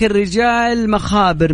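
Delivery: "الرجال مخابر" spelled out slowly, word by word; slow proverb reading. Shown as a demonstration. Host slowly reading a proverb to the caller, drawn out. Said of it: "والله دايم يقولونها".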